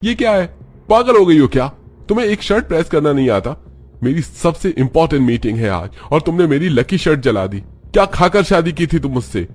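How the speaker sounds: husband yelling at his wife; angry yelling. Acted. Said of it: "गुस्सा होते हुए कहता है". (गुस्से में चिल्लाते हुए डाँटना)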